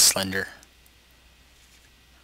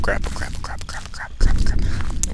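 rapid whispered repetition; frightened, voiceless muttering on a loop; panicked whisper. Real, not acted. Scared muttering while playing.

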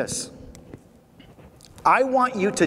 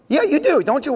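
prodding; 8 semitones higher than usual. Real, not acted. Prodding audience to agree.

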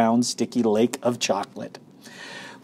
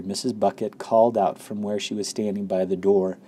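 ordinary delivery

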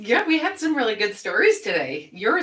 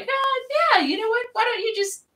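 much higher, playful mock-eager voice; mock eager woman. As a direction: pretend to fall for him, high and giddy. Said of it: "definitely would not be like".

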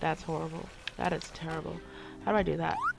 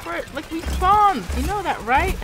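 annoyed gamer rant; exasperated scolding; 7 semitones higher than usual. Real, not acted. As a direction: scold the team in disbelief. Scolding teammates.

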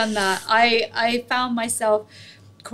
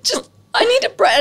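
much higher and louder, breaking into a cry; retold sobbing; 9 semitones higher, 4 dB louder than usual. Exhausted cook recalls sobbing to her mum, tearful and high. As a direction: let the voice crack as if overwhelmed. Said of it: "just in the throes of it".

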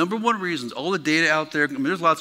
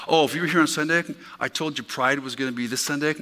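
ordinary delivery

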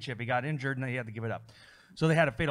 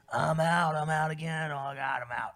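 imagined injured wrestler. Pretend. Host imagines the wrestler announcing he's out, mock lament. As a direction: chant it as a weary mock announcement. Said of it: "gonna be him saying".

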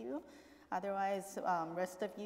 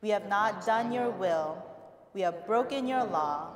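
liturgical prayer; 9 dB louder than usual. Real, not acted. Leads spoken confession prayer.

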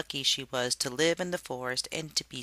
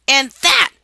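fierce shout; 11 semitones higher, 16 dB louder than usual. Acted. Striking the snake.

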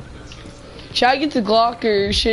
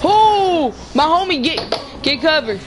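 excited alarm; 6 semitones higher than usual. Real, not acted. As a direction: cry out to a teammate under fire. Reacts to gunfire in game.

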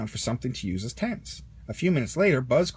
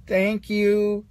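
Rex thanking; 6 semitones higher, 5 dB louder than usual. A narrator's reading. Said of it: "said Rex".

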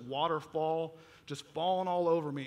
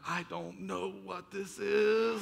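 drawn-out, wailing mock panic; mock freak-out; 3 semitones higher, much slower than usual. Teen feeling electricity through his arms, mock freak-out. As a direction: stretch it slowly into a comic overwhelmed cry.

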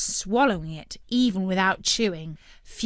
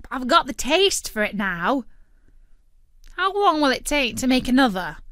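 loud, higher greedy bird; brash turkey voice; 5 semitones higher, 6 dB louder than usual. A narrator's reading. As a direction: argue like a greedy cartoon bird.

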